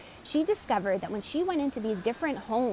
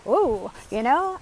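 drawn-out, louder ooh, like backing away; wary ooh; 2 semitones higher, 7 dB louder than usual. Pretend. Coach voicing a listener sensing bad energy, wary. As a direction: recoil at a heavy feeling. Acting out a wary inner reaction.

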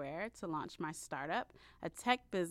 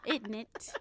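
usual speaking voice

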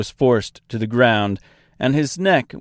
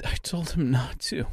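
breathy whisper aside; 3 semitones higher, 8 dB quieter than usual. Acted. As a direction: whisper it flatly. Aside after the bird's death.

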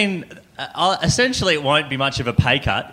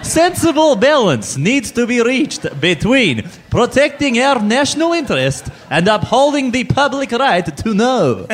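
put-on slow heavy Russian accent; thick Russian accent, slow and loud; Marise Payne in Russian accent; 8 semitones higher, 4 dB louder, slower than usual. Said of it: "saying this in a Russian accent".

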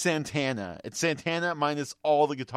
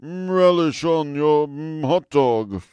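a hint of a slow, relished Christopher Lee voice, louder; Christopher Lee voice attempt; 3 semitones higher, 12 dB louder, slower than usual. Shown as a demonstration. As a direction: savour each word like Saruman. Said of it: "I can't do it".

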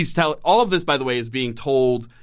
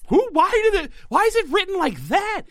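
high-pitched, baffled protest; incredulous outrage. Retold or imitated. Co-host reacts to clumsy script, high-pitched incredulity. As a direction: question it in exasperated disbelief, pitch way up.

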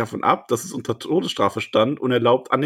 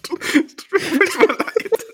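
mock dying; slower than usual.

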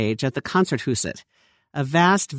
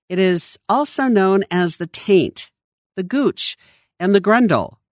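higher, carefully separated words, innocently said; innocent enunciation; 5 semitones higher than usual. A narrator's reading. Scientist telling the Copenhagen crowd slang terms, innocent enunciation. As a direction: list each term innocently, pausing between them. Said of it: "She enunciated the words with an innocence".

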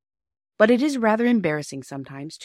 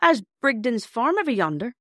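higher-pitched, mildly grumpy countryman; mildly grumpy signalman; 7 semitones higher, 3 dB louder than usual. Acted. Signalman grudgingly directing the children to a farm, mildly grumpy. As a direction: give directions with growing grumpiness. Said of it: "more and more grumpy".

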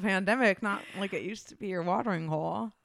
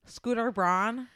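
ordinary delivery

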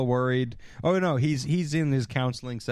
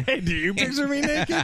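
higher, laughing mock-coy question; laughing mock Andrea; 10 semitones higher than usual. Friend re-voicing Andrea's naked question, laughing high. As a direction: play Andrea's line while cracking up.

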